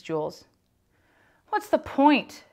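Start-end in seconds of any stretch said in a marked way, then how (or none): 1.5-2.4 Myron's high, louder despairing question; gloomy kid asking. Acted. Gloomy Myron questions the teacher, high and despairing. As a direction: sigh it out like a boy who sees no point. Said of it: "Myron asked".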